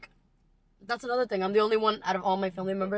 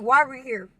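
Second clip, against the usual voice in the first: re-enacted demand, higher and louder; insistent child's question; 5 semitones higher, 9 dB louder than usual. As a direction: press the question on the teacher. Repeats child's question, incredulous.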